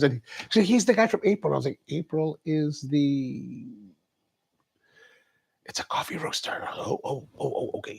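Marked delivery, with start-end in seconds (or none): 1.9-6.7 hushed aside. Retold or imitated. Mock aside about the famous stranger. 6.7-8.0 sheepish hush. Retold or imitated. Sheepish realization after whispered explanation.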